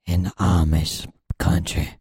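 deep, put-on macho delivery; man voice.

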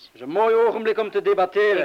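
sarcastic. Acted. Man snaps sarcastically at the young woman while defusing. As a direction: bite it out sarcastically.

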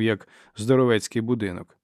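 plain reading voice

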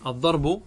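"الضرب" said ad-darbu, vowelless r then bu; voweled final ba. Shown as a demonstration. Teacher points out the vowels, "الضرب" said ad-darbu. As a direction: end "الضرب" on a clear bu. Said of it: "الباء متحركة".

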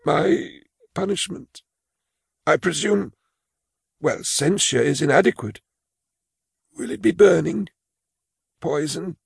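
put-on high, timid old clerk voice; a frail old clerk confessing his fear; old man Imus. Imus asks about punishment.

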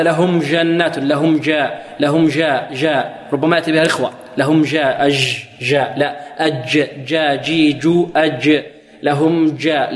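جاء with a loose, sliding jeem like zh; soft jeem. Retold or imitated. Teacher imitates students softening the jeem. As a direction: let the jeem in جاء flow without a stop. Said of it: "يقول هكذا".

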